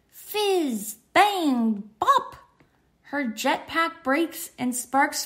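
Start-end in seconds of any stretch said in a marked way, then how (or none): 0.1-2.4 popping sound effects. Acted. Jetpack breaking sound effects.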